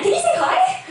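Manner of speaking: bubbling over with delight; excited laughing question. Retold or imitated. Friends excitedly ask the dying mum to greet Jesus, laughing. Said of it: "we were actually really excited".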